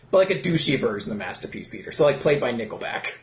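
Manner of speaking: ordinary talk, nothing marked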